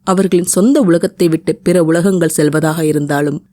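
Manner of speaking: ordinary delivery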